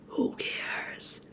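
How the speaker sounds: breathy whisper; hushed who cares. Pretend. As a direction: whisper it to yourself. Repeats phrase in varied voices.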